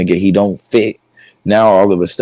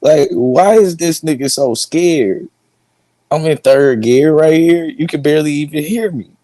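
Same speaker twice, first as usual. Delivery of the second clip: laughing; 7 semitones higher than usual. Real, not acted. Laughing at a video.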